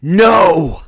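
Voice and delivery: loud high shout. A narrator's reading. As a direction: scream it in his face. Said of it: "I shouted at him".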